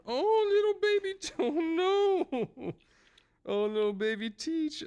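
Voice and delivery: put-on very high baby-talk squeal; high-pitched playful cooing; mock gushing. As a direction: gush over a tiny baby, way up high. Gushing over baby character in story.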